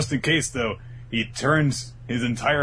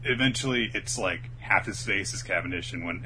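usual speaking voice

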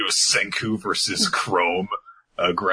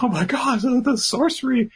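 high-pitched awestruck onlookers; hammed-up astonishment; mock amazement. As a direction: gasp it high in pretend awe.

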